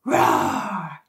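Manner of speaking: low, growling roar; deep warrior roar. Shown as a demonstration. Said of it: "you say roar".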